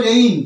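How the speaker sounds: রেইন with the vowel gliding from e into i; correct pronunciation. Shown as a demonstration. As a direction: say রেইন as "re-in", with an "ei" glide. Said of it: "এর সঠিক উচ্চারণ হবে".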